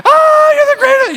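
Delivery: very high, loud squealing delight; ecstatic dog. Pretend. Pastor voicing a dog thrilled at anyone walking in, squealing high. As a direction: gush like an overjoyed dog greeting its owner. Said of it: "they're going".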